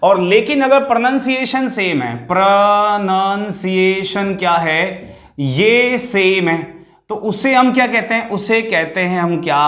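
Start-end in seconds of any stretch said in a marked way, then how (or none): 2.3-6.8 slow emphasis. Shown as a demonstration. Teacher stressing key answer to pupils.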